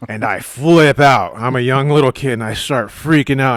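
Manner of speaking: loud, high, worked-up storytelling; retold panic. Comic retelling of freaking out over an ant, loud and frantic. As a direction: raise pitch and volume as if reliving the panic.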